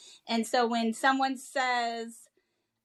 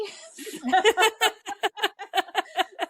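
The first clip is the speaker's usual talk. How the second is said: big real burst of laughter, higher; loud laugh; 8 semitones higher, 6 dB louder than usual. Real, not acted. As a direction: crack up at yourself. Laughing at own story.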